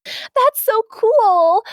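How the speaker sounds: high squeal, slow; squealing fan. Retold or imitated. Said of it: "Someone said".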